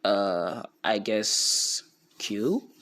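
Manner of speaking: hesitant, slow guess rising into a question; drawn-out questioning guess; confused. Retold or imitated. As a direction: imitate someone puzzled, unsure of the answer. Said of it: "he's confused".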